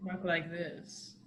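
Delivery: low, soft, slow seductive murmur; sexy deep voice. Shown as a demonstration. Player replaying their allergy voice for co-host, sexy deep voice. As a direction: drop deep and very quiet. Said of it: "This is my sexy, deep voice".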